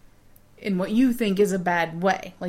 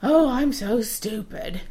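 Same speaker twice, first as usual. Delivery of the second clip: mock self-pity; 3 semitones higher, slower than usual. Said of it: "If, if you say".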